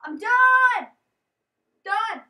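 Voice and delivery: high, loud shout of giving up; fed-up shout. Real, not acted. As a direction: yell that you quit. Quits after dying.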